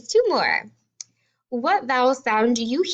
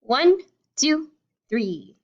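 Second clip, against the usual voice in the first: usual speaking voice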